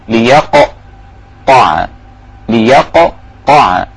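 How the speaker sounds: ليقطع very slow, the ق bouncing hard and quick; qalqala on qaf. Shown as a demonstration. Teacher shows the strong qalqala of ق in ليقطع. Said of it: "شوف القلقلة".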